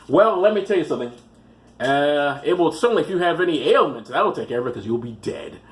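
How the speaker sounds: ordinary talk, nothing marked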